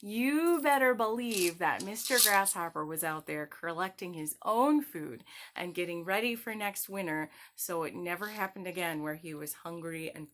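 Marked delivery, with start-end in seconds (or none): none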